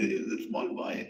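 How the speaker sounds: high, amused laughter running into the words; laughing. Real, not acted. Teller amused by the small boy's ambition, laughing. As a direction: laugh through the remark.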